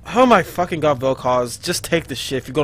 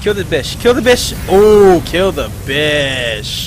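loud, high egging on; hyped shout urging the team; cheering shout; 4 semitones higher, 7 dB louder, much slower than usual. Real, not acted. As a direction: yell at the screen to finish her. Urging team to kill enemy.

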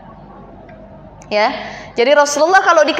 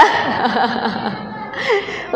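soft laugh; 3 semitones lower, 7 dB quieter than usual. Real, not acted. Speaker laughs at own joke.

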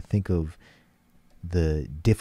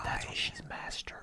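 whispering; 14 dB quieter than usual. Real, not acted. Awed aside about singer.

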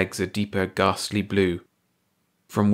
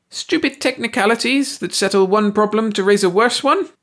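raging; 13 semitones higher, 5 dB louder than usual. A narrator's reading. Ursi keeps raging.

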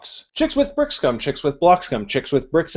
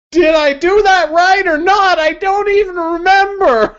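high confused squeal; 13 semitones higher, 5 dB louder than usual. Real, not acted. Confused after a fumbled tongue twister.

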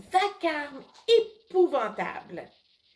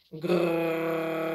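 low steady engine growl; roaring snowblower; 6 semitones lower than usual. A narrator's reading. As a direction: rumble like a machine. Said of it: "qui rugit toujours".

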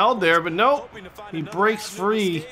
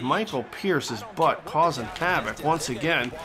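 usual speaking voice

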